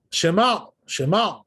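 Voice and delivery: "Shema" with a deep guttural Ain; "Shema" pushed from the throat on the Ain. Shown as a demonstration. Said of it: "the Ain has to be deep".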